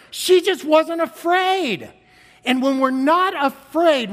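joyful sermon peak; fervent preaching. Acted. Preacher rising to full force.

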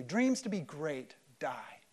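ordinary delivery

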